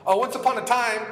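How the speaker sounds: preacher mocking the idea of faith as an old tale; mocking fairy-tale tone. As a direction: lift it like a mock bedtime story. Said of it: "Not once upon a time".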